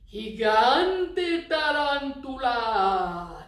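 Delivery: very slow, loud, scary monster voice on "gigante tarantula"; really scary. Shown as a demonstration. Teacher showing kids a scary voice, slow and loud. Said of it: "really scary".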